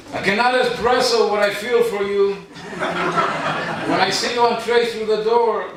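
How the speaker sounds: a joke love poem read with a swoon; comic love poem. Acted. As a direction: ham up the passion and chuckle through it.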